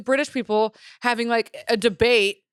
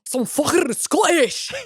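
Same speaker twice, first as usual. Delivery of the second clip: slow, higher Scottish-accented Some fucking Scottish; 5 semitones higher, slower than usual. Shown as a demonstration. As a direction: drag it out like a Scot. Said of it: "this one's Scottish".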